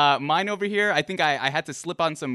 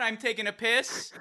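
ordinary delivery

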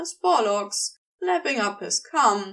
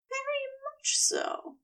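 Eliza's voice; 7 dB quieter than usual. A narrator's reading. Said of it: "she said".

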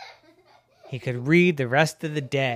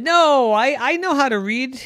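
squeaky, hurried frog protest; high excited protest; 11 semitones higher, 6 dB louder than usual. Acted. Frog protests at glasses store.

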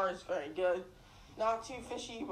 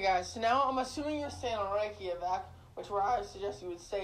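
usual speaking voice

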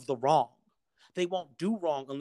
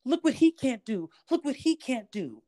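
mocking adult voice; 11 semitones higher than usual. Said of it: "So now you stump the little child's creativity because".

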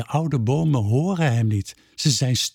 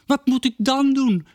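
character voice; 11 semitones higher than usual. A narrator's reading. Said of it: "vraagt Ravi".

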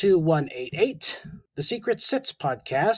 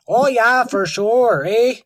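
hammed-up Canadian accent, higher and louder; mock Canadian accent; 5 semitones higher, 7 dB louder, slower than usual. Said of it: "stereotype of Canadians".

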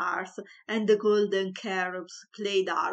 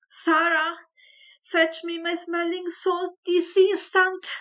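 loud, much higher, frantic summons; distressed call; 10 semitones higher, 7 dB louder than usual. A narrator's reading. Distressed mother calling the maid for smelling salts, loud and high.

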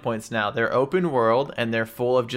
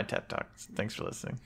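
whispered; 12 dB quieter than usual. Pretend. Mock TED talk sign-off.

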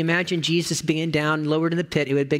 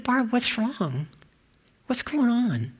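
concerned; 8 semitones higher, slower than usual. Retold or imitated. Pastor asks crying friend.

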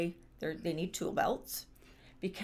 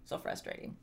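ordinary talk

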